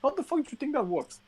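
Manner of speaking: outraged, squeaky high disbelief; incredulous rant. Real, not acted. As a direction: pitch shoots way up in disbelief.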